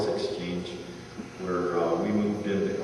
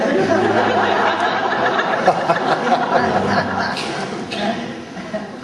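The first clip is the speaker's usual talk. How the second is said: loud laugh; 12 semitones higher, 9 dB louder than usual. Real, not acted. Laughing at the anniversary joke.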